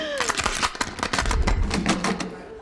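sharp delighted intake of breath; stunned gasp. Acted. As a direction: gasp in thrilled shock. Marriage proposal, stunned gasp.

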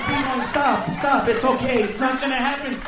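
ordinary talk, nothing marked

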